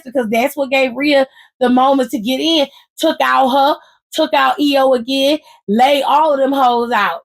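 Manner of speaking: ordinary delivery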